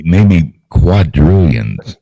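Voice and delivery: very slow, loud "quadrillions"; drawn out. Pretend. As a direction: stretch "quadrillions" out big. Joking grand claim.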